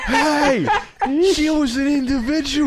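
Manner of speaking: shrieking high laughing parody of his line; mock his Sonic voice, way up high. Friend mocks the actor's line, high laughing squeal. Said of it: "your voice".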